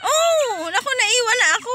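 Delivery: loud and high, left behind; excited exclamation. Real, not acted. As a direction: call out loudly in alarm.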